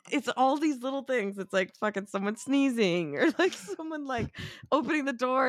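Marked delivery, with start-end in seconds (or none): none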